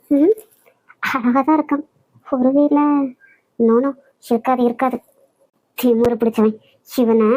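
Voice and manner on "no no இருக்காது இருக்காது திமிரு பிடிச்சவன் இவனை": usual speaking voice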